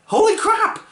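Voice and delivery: high-pitched, thrilled outburst; fanboy squeal of amazement; excited exclamation. Real, not acted. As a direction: blurt it out in sheer delight. Excited reaction to Trigon's power.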